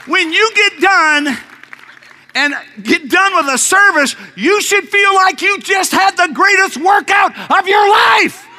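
high, loud shouted preaching; fiery preaching. Acted. Preacher fires up the congregation, high loud shouting. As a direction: preach it to the rafters.

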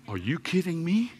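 ordinary delivery

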